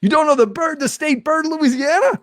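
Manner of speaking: high, incredulous question; can't believe he doesn't know; surprised disbelief. Real, not acted. Host shocked guest doesn't know.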